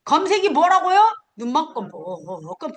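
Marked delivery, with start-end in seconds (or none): 0.0-1.0 raised, louder scolding question; mock-angry. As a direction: demand it with pretend anger. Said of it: "내가 막 이렇게 화내". (화내듯이 따져 묻기)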